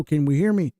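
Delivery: ordinary talk, nothing marked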